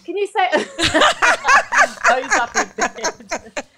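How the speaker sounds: high, loud laughter through the words; helpless giggly delivery; real laughing. Real, not acted. Cracking up mid-chat with toddler.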